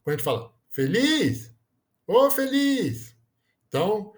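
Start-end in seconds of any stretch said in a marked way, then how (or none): 0.7-1.5 "feliz" with LIZ stretched high; feLIZ, rising and lingering on the last syllable; called-out word, last syllable stressed. Shown as a demonstration. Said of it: "vamos chamar aqui a última palavra". 2.1-3.2 "ô feliz" hailed high and slow; ô feLIZ, high drawn-out final syllable; calling out, last syllable stressed. Shown as a demonstration. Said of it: "vamos chamar aqui a última palavra".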